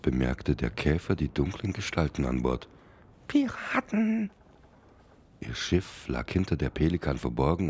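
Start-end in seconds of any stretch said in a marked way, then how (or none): 3.3-4.3 tiny high-pitched beetle calling out; small insect voice, called up the ship. Acted. Beetle spots pirates.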